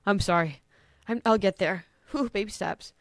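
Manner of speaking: usual speaking voice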